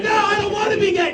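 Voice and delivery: high, comic pleading; hammed-up refusal; mock panicked protest. Acted protest against the verdict.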